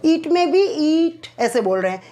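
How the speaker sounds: normal talking voice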